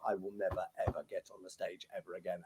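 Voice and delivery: muttered vow. Retold or imitated. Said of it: "I vowed to myself".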